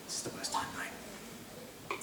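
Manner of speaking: whispered aside. Real, not acted. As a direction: whisper it in mock despair. Despairing aside.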